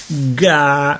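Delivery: put-on a small child's calm syllable; calm baby babble. A narrator's reading. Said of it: "disse Kenneth tranquillo".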